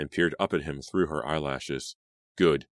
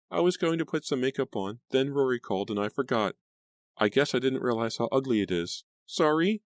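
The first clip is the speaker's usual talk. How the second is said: put-on much higher, meek hurt girl; timid Grace voice; 9 semitones higher than usual. A narrator's reading. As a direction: apologise like a little mouse.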